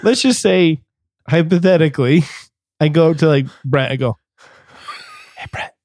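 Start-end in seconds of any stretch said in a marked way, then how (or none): 5.4-5.7 hushed, close-up whisper; creepy ASMR whisper. Acted. Creepily approaching a friend, ASMR whisper. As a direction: softly whisper for someone's attention. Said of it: "This could even get creepier".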